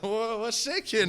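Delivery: retold dancing rapper. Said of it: "He's like".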